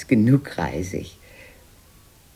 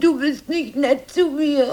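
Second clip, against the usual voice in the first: high tearful whine; whining, close to tears. Acted. Sister complaining to her brother, high tearful whine. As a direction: whimper it like a hurt child. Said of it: "Heul nicht, Greta".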